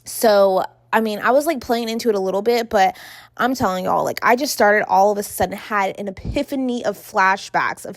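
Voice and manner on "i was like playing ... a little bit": plain reading voice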